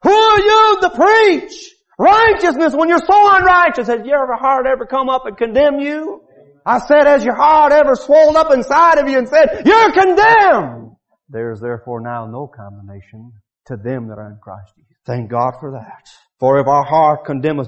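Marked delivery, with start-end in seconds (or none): 0.0-11.0 high, loud hellfire preaching; preaching at full force. Acted. Preacher to sinners, loud fiery preaching. 11.3-15.0 quiet scripture reading. Acted.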